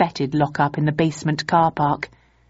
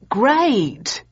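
high, bright but a little forced; uneasy enthusiasm; 7 semitones higher than usual. A narrator's reading. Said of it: "he enthused, slightly uneasily".

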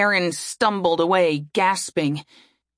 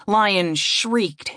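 usual speaking voice

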